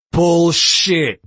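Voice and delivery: explosive, drawn-out outburst; yelled. A narrator's reading. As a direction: yell it out in disbelief. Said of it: "Groman yelled".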